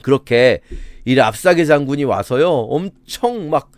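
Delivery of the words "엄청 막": ordinary delivery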